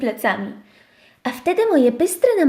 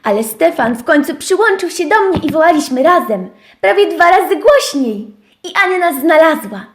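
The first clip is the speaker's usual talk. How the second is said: plain reading voice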